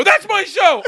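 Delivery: loud, high sign-off; big show-closing holler; triumphant shout. Retold or imitated. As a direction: shout it as the mic drops. Triumphant mic drop sign-off.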